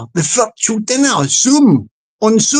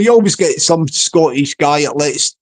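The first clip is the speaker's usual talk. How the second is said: ordinary delivery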